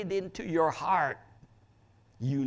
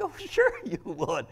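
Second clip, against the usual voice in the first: normal talking voice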